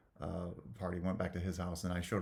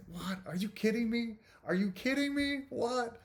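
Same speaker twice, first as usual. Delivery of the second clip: soaring high, half-shouted what, are you kidding me; incredulous disbelief. Retold or imitated. Son watching dad get his dream car, squealing high disbelief. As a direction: squeal your disbelief, climbing higher each time. Retelling own shock at reveal.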